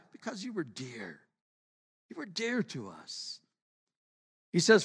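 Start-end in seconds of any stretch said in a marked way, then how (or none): 0.1-3.4 tender. Real, not acted. Tender aside about caring for them.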